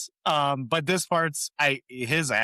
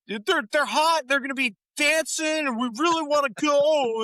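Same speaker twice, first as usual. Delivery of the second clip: much higher, whining mock plea; whiny plea; 4 dB louder than usual. Joey whining to Monica to let them leave, high whine. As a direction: beg like a kid who wants to leave.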